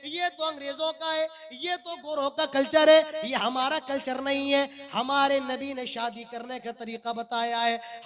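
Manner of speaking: loud, declaiming sermon with drawn-out phrase endings; full-force preaching. Acted. Preacher warning his brothers of punishment in hell, preaching at full force. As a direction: thunder out a grave warning to the congregation.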